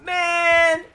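high, loud protesting yells; dismayed shout. Real, not acted. Gamer protesting his death, dismayed shouting. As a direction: yell it out, then drag the last cry downward. Losing a fight in the game.

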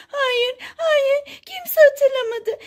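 high, whiny doll protest; Cry Baby. Pretend. Doll refusing comfort about its birthday, high mock-tearful voice. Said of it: "Sen neden üzüldün ki?".

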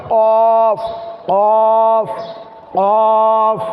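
long, rounded কফ from the root of the tongue; back-of-tongue Q. Shown as a demonstration. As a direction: raise the tongue root to the palate for a drawn-out কফ. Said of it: "জিহ্বার গোড়া তার উপরের বরাবর".

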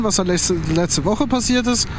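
normal talking voice

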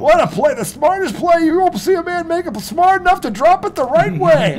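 high, loud mock gushing; hammed-up commentator gushing; sarcastic praise. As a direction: gush over the drop with heavy sarcasm.

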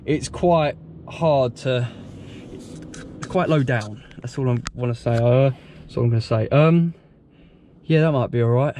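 normal talking voice

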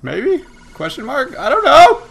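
slow start climbing to a high, loud cry; giddy questioning. Real, not acted. Gamer doubting the win was easy, giddy questioning. Unsure celebration after winning.

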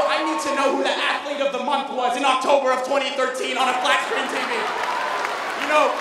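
loud, mocking sarcasm about useless screens; sarcastic rant. Comedian to school crowd, loud sarcastic rant.

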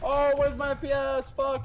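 frustrated yell. Real, not acted. Game lagging in a fight.

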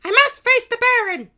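very high, loud heroine voice; triumphant princess. A narrator's reading. Princess Kitty leaving to face the Baron, triumphant. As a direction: declare it loudly as a cartoon princess. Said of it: "she said in triumph".

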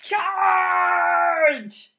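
shouted battle charge; loud heroic charge cry. Acted. Spider charging to the rescue.

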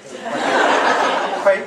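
laughing. Real, not acted. Laughs at the Slob nickname.

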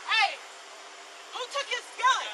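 yelling across the room. Real, not acted. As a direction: call out across the scene. Officer calls out to others.

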